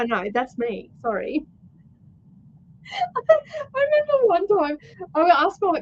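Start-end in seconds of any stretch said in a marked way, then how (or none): 2.8-5.8 laughing. Real, not acted. Laughing fit while starting story.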